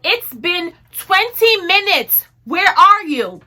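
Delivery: loud, high and drawn-out demand; retold fed-up phone call, stretching each word; exasperated call. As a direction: demand answers, loudly, at the end of patience. Retold angry call after waiting.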